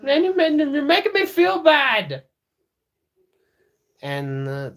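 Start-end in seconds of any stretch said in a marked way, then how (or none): 0.0-2.2 high, loud, hammed-up wail; mock whining. Learner answering the guilt-tripping app, mock wailing. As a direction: whine it loudly, way up high. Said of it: "Be like".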